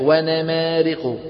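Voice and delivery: ونمارق chanted slowly as "wenemeriq"; imala. Retold or imitated. Teacher mimics a reciter's error, fatha tilted. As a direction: tilt every a of ونمارق toward eh. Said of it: "هذه اللي سميناها الإمالة".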